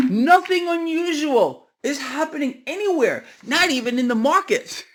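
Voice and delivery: high-pitched sarcastic assurance tipping into a laugh; mock denial, amused. Real, not acted. Commentator mocking the official line on markets, sarcastic and amused. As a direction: insist on it in a high voice, then crack up. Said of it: "I'm laughing, but it's just, it's too much".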